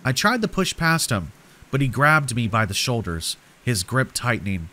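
plain reading voice

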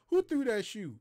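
loud, high demanding shout; the sergeant, hit by a shoe, demanding who threw it; angry sergeant shout. Retold or imitated. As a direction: bellow it at the whole line. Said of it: "And he was like".

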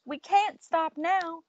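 put-on high, slow call from an old man; mild narrated holler. A narrator's reading. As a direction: holler it drawn out, without full volume. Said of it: "An old man hollered".

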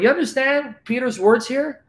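ordinary delivery

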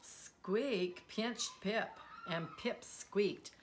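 ordinary delivery

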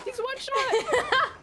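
excited callout. Real, not acted. Urgent callout in fight.

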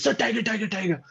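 yelling the alarm at the tiger; retold panicked shout. Guide in front spots the tiger, retold panicked shout. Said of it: "this idiot screamed".

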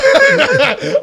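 sudden high loud cackle of laughter; burst of laughter. Real, not acted. Laughing hard at a friend's joke, loud high cackle. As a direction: crack up laughing at the friend's reaction. Friend teasing about being pissed.

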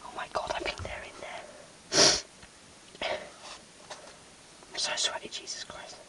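faint tense whisper; whispering while hiding. Real, not acted. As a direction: hush it, they're close. Hiding in cupboard, seekers outside.

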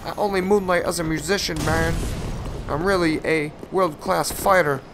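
high, put-on voice of a smug fighter; mocking the opponent. Mocking the enemy player as a cocky fighter. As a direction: puff it up like a braggart drummer. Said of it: "He's like".

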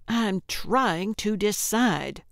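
usual speaking voice

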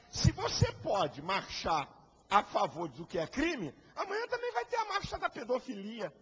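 ordinary delivery

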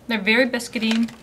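talking with a mouth full of food. Real, not acted. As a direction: chew and talk through a mouthful. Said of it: "I'm speaking with my mouth with food in it".

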